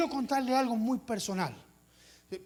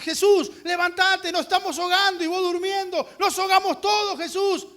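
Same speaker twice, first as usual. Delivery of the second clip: high, loud panicked cry for help; 8 semitones higher, 8 dB louder than usual. Retold or imitated. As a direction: scream like the boat is sinking. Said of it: "Ellos fueron a Jesús y dijeron".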